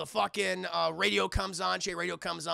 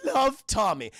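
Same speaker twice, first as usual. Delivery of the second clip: high, loud mock-kid voice; hammed-up spite, pitched way up; hateful child's letter; 7 semitones higher, 5 dB louder than usual. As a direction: yell it like a bratty letter writer. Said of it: "They're basically saying".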